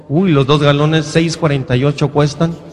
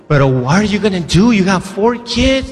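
worried lady; 3 semitones higher than usual. Retold or imitated. Lady questioning a father of four, mock alarm. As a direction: act out a woman's incredulous questioning, stretching the end. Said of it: "Una señora así".

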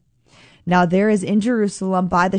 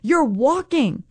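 amazed shout, much higher; 7 semitones higher than usual. Pretend. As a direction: gasp in astonishment at the healed man. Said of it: "they're not like".

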